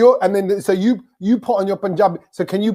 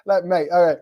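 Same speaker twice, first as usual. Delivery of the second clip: usual speaking voice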